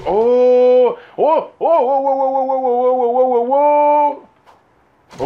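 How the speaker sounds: rapid string of high "oh"s ending loud; repeated amazed hoots, last one held; hammed-up run of wonder cries; astonished whooping. Astonished at sight in game.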